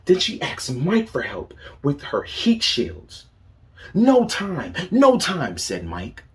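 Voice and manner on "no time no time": higher and louder, a busy bird rushing off; hurried brush-off. Acted. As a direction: toss it off, too busy to stop. Busy Mike brushes Zelda off.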